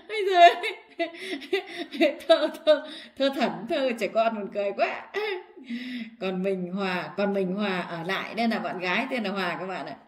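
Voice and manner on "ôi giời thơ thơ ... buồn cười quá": bursting into high laughter while reading; laughing out loud. Real, not acted. Man laughs at his childish soldier poem, laughing hard.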